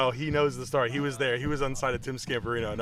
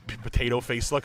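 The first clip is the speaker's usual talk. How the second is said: ordinary delivery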